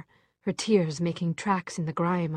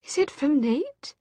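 hopeful; 10 semitones higher, 4 dB louder, slower than usual. Acted. Desperate hopeful question.

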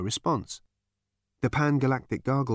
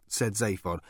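plain reading voice

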